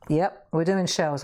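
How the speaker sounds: ordinary talk, nothing marked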